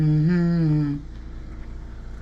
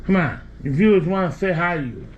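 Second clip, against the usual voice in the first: ordinary talk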